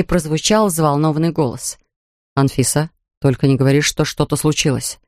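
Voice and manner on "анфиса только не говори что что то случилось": Vilar's lower voice. Acted. Vilar's worried male voice.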